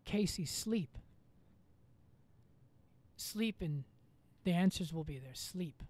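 half-whispered call from nowhere telling him to sleep; hint of a ghostly voice; mysterious voice. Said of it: "he heard this voice say".

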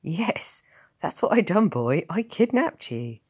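loud, high, gleeful admission; bursting with laughter. A narrator's reading. Guardian realising what he has done, laughing. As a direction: crow it loudly as if about to laugh. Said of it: "he burst into laughter".

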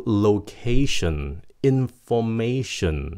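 slow word list, "tion" said "shin"; "shin" endings. Shown as a demonstration. Teacher gives examples, "tion" as slow clear "shin". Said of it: "T I O N là âm shin".

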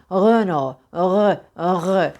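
"Renault" with a throaty uvular R; guttural French "r", no tongue trill. Shown as a demonstration. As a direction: scrape the R of "Renault" from the back. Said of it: "Genizden gelir".

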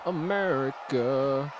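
a slow, low, soft America; drawn-out America. Pretend. Savoring his character's country.

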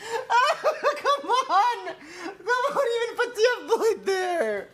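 high, drawn-out wail; laughing protest. Real, not acted. Streamer protesting a drawing's detail, laughing. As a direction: whine in disbelief through laughter.

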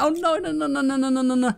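high, rapid string of no's; panicked no's. Real, not acted. Gamer watching a monster come closer, panicked. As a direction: plead as it closes in. Monster approaching in horror game.

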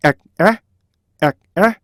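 "에, 에" split by a K stop; 두 음절로 분리. Shown as a demonstration. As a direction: cut the first "에" short, then say the second. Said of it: "이렇게 나오세요". ("에, 에"를 K로 끊어 두 박으로)

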